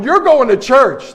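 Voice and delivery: high, loud, drawn-out command; acted-out bossy shout; shouted order. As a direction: holler it like rounding up kids. Retold ordering kids into the van.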